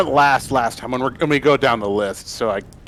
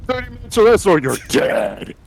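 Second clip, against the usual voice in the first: mock menacing slogan; 9 semitones higher than usual. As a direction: bark it like a horror promo. Mock pizza slogan threat.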